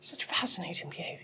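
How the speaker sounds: hushed mock naturalist; Jane Goodall. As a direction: whisper as if watching wildlife. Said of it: "This is Jane Goodall".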